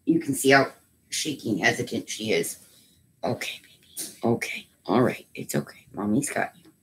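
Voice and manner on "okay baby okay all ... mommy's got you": hushed soothing, near whisper; calm a trembling little one. Real, not acted. Calming a shaking pet.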